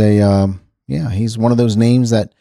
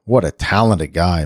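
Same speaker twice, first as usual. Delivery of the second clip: ordinary talk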